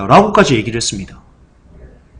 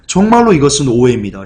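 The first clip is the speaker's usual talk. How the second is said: normal talking voice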